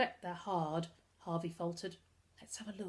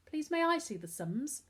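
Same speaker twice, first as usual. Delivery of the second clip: higher, stiff and small; stiff little voice; 8 semitones higher than usual. A narrator's reading. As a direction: ask it stiffly and primly. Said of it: "said a stiff little voice".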